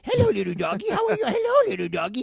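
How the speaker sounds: high, loud sing-song dog talk; baby talk to a dog. Pretend. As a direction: coo at the little dog. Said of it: "Right, I'm like".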